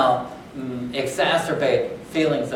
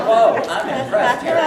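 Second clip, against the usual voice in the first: mock impressed; 11 semitones higher, 5 dB louder than usual. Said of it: "I was like".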